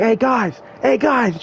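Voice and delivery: high-pitched retold call to friends; calling friends over. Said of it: "she calls for her friend".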